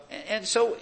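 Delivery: ordinary talk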